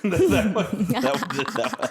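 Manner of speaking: high-pitched laugh breaking through the words. Real, not acted. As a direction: laugh it out as you repeat yourself. Laughs at his own joke.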